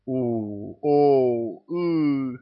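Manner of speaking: rising muffled moans; strange moans. A narrator's reading. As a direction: moan through clenched teeth. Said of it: "не менее странными стонами".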